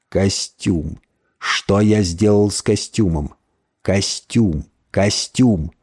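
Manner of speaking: low, soft lament; anguished moaning. A narrator's reading. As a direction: groan weakly over a great loss. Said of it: "тихо стонал". (тихое низкое стенание)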